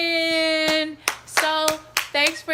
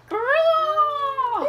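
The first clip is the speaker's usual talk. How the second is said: cheering; 11 semitones higher, 6 dB louder than usual. Acted. Cheer at song end.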